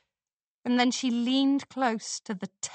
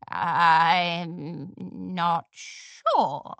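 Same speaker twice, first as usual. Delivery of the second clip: put-on slow unsure old mare; 5 semitones lower, much slower than usual. A narrator's reading.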